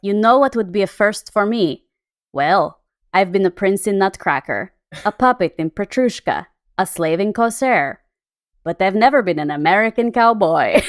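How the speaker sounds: put-on light Russian accent. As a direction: Baryshnikov in a mild Russian accent. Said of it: "I'm gonna try to do a Russian accent".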